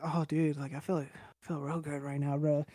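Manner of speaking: low, soft stoned contentment, lightly re-enacted; blissed-out. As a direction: sink into a mellow, contented mumble. Acting his blissed-out high self.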